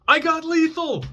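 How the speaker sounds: high, loud mock cop shout; imitating a cop yelling. Cop warning the suspect he has lethal force, high loud shout. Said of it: "the cop yells".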